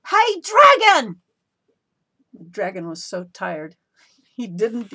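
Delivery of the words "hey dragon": very loud, high, slow yell; shouted as loud as she could. A narrator's reading. Princess yelling into the sleeping dragon's ear. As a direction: bellow it right into his ear. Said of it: "she shouted as loud as she could".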